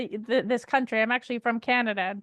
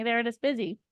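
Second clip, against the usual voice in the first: usual speaking voice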